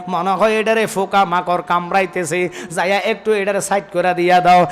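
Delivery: ordinary talk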